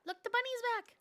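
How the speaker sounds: high, bright look-there; thrilled little exclaim; excited spotting. Real, not acted. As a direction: point it out with delight. Player spots the bunny.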